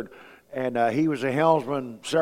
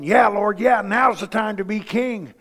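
eager crowd cheer; 5 semitones higher, 3 dB louder than usual. Retold or imitated. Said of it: "they were probably going".

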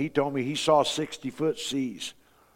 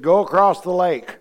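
firm command; 6 dB louder than usual. Retold or imitated. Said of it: "He said".

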